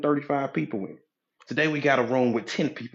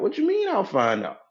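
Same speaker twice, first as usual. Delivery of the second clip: high-pitched mock bafflement; incredulous questioning; 8 semitones higher than usual. Lost driver answering a vague guide, incredulous and high. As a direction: question it in rising disbelief. Acted driver doubting the directions.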